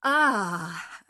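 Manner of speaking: loud, mildly anguished cry; dismayed cry. A narrator's reading. Merchant hearing the beast demand a daughter, dismayed cry. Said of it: "cried the merchant".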